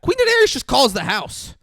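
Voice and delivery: loud, high excited telling. Real, not acted. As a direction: burst out with the news. Excited retelling of a scene.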